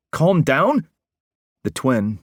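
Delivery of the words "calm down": higher and louder again, calm down near a screech. Acted. Parker screeches calm down back at Will, losing control. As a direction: spiral up into a screech. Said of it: "was practically screeching now".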